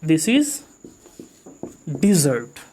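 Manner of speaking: dessert said as "dizert", stress on the second syllable; pronunciation demo. Shown as a demonstration. Teacher modelling dessert as di-ZERT for the class. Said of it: "हम इसको पढ़ते हैं डिजर्ट".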